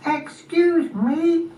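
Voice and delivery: very high, drawn-out snail call; tiny high voice, stretched slow. Acted. Unseen snail calls to Spot.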